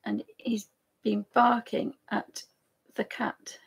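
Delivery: whispered aside. Real, not acted. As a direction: whisper it so the dog won't react. Whispering so dog won't hear.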